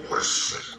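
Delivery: rushing car whoosh made with breath; imitated whoosh. Game master imitating the car pulling up, breathy whoosh. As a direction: blow out a swooshing noise.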